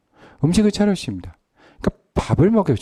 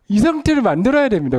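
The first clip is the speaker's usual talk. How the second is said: emphatic exhortation; 9 semitones higher, 4 dB louder than usual. Acted.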